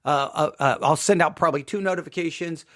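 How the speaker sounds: usual speaking voice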